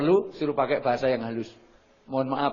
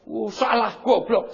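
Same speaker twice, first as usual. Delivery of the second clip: higher, louder mock scolding; scolding parent; 7 semitones higher, 5 dB louder, slower than usual. Parent scolding a child for a mistake, mock anger. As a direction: snap it like an impatient parent.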